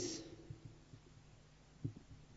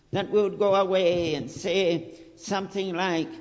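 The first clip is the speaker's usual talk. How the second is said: fervent preaching; 3 semitones higher, 4 dB louder than usual. Acted. Preacher urging his congregation at full force. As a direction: preach it out to hold them back.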